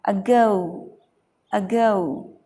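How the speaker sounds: अगो with the weight on गो; अगो said uh-GOH; stress on g. Shown as a demonstration. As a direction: push the g in each अगो. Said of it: "थोड़ी ताकत लगानी है".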